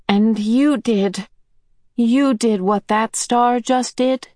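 put-on slow, awed little boy's question; lightly voiced boy, wondering. A narrator's reading. A hint of Charles Wallace asking Missus Whatsit, awed. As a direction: ask it slowly in wonder.

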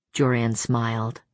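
ordinary delivery, nothing marked

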